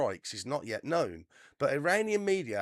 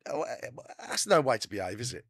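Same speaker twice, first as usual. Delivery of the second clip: usual speaking voice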